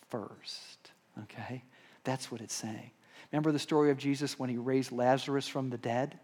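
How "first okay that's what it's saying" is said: hushed. Real, not acted. Sermon drops to a hush.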